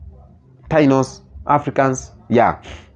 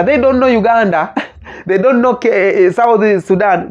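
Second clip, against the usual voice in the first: laughing; 7 semitones higher than usual. Real, not acted. Laughs about Americans' ignorance.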